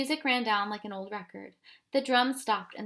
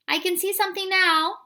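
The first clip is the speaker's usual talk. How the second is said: higher, louder cry; cried out; 10 semitones higher, 7 dB louder than usual. A narrator's reading. Tom shouting from the tree, an excited cry. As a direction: call out the sighting. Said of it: "cried Tom".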